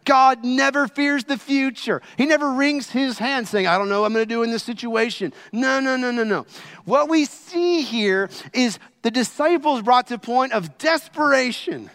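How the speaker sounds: high, loud impassioned preaching; preaching at full force. Acted. Preacher urging the congregation with fiery conviction. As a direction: drive it home from the pulpit.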